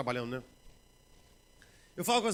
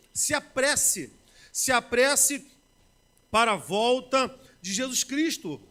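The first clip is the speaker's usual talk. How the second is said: loud, slow, insistent preaching; a pastor pleading loudly with the church to hurry; fervent preaching; 3 semitones higher, 6 dB louder, much slower than usual. Acted. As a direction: drive the call home from the pulpit. Preaching climax, urging the congregation.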